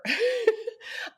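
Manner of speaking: high laugh. Real, not acted. Laughing at her own memory.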